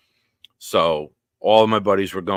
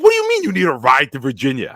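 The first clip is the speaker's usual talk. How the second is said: fast, loud, higher disbelieving outburst; incredulous coach; 6 semitones higher, 8 dB louder, faster than usual. Retold or imitated. Coach can't believe the student wants a bus ride, loud incredulous.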